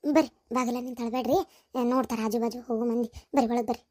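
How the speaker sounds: soft, low, discreet urging; hushed coaxing. Acted. Urging sister-in-law inside before passers-by notice, hushed coaxing. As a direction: coax her indoors under your breath. Coaxes weeping visitor in, neighbours watching.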